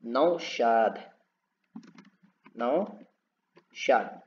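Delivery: Naushad very slow, the औ held long; drawn-out word. Shown as a demonstration. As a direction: stretch the औ in Naushad. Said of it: "कैसे लिखेंगे?". (औ खींचकर नौशाद)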